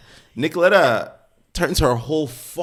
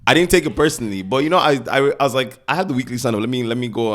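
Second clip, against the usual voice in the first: normal talking voice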